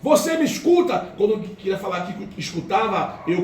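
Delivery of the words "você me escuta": put-on woman calling to the dying young man. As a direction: shout to reach someone fading away. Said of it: "Aí ela".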